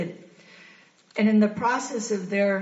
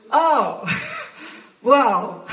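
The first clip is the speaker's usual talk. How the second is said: shocked exclamation; 5 semitones higher, 6 dB louder than usual. Retold or imitated. Sister's shocked reaction voiced.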